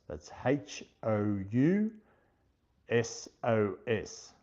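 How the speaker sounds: slow, letter by letter; spelling it out. Shown as a demonstration. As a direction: spell the name out slowly, each letter apart.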